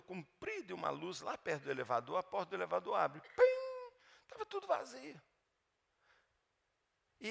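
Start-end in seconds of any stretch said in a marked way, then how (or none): 3.2-3.9 elevator chime. Retold or imitated. Elevator door opening.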